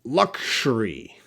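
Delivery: "Luxury" said slowly as LUK-shuh-ree, with an SH sound; slow pronunciation demo. Shown as a demonstration. Teacher showing students the SH sound in "Luxury", slowly. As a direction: stretch out "Luxury" and land on the sh in the middle. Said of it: "I pronounce that lux, S H sound there".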